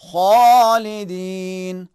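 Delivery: خالدین with a thick, full خ, slow; heavy kha. Shown as a demonstration. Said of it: "اسی انداز میں موٹا تلفظ کریں گے".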